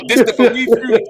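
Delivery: hammed-up Jamaican accent; mock Jamaican accent. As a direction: put on a Jamaican lilt for a joke. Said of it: "you turn Jamaican".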